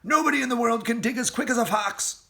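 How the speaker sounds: fast proud boast; excited. A narrator's reading. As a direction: crow it while digging. Fox digging fast.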